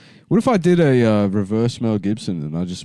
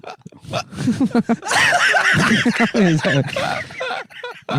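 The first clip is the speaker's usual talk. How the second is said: high breathy laugh breaking the bit; cracking up while still in the high accent; high laughter; 12 semitones higher than usual. Real, not acted. A friend laughing at his own mock accent.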